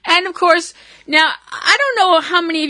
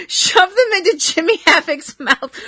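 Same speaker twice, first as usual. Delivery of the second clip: giggling through the words, high; laughing helplessly while describing it; 9 semitones higher than usual. Real, not acted. Podcaster cracking up at the gross wrestling spot, laughing.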